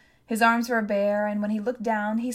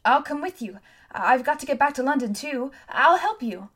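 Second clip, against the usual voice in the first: high, louder, insistent offer; eager offer; 4 semitones higher, 6 dB louder than usual. A narrator's reading. Boy hurrying beside girl, eager to join her. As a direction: offer help urgently, voice raised.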